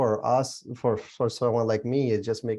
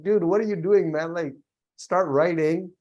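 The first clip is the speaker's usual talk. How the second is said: mock yell; 5 semitones higher, 3 dB louder than usual. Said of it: "yells at him sometimes".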